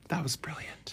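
whisper. Pretend. Host whispering back about the secret episode. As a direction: breathe it, keep it secret.

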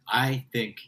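ordinary talk

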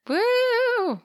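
high whooping cheer; excited whoop. Real, not acted. Cheering the new decade with a high whoop. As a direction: let out a high celebratory woo.

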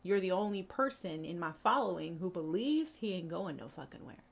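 ordinary talk, nothing marked

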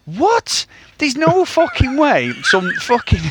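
mock disbelief, pitched high; incredulous outburst. Podcaster voicing the guardian's reaction to Bond, mock incredulous. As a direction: act out a high, baffled protest with a laugh breaking in.

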